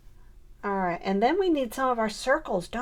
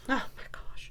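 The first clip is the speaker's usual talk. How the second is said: whispered exasperation; 4 semitones higher, 6 dB quieter than usual. Real, not acted. Exasperated aside while scrapbooking.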